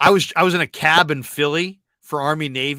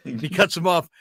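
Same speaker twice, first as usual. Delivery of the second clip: ordinary talk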